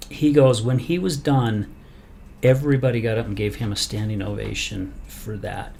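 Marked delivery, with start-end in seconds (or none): none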